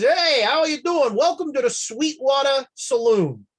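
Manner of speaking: a hint of a hearty barkeep; lightly voiced cheerful bartender; friendly barkeep. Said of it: "and he says".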